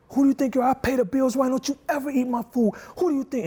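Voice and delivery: high, loud furious father yelling; angry yell. Retold or imitated. Father yelling at son over eaten wings, furious. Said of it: "I would have yelled".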